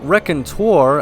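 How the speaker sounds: ordinary talk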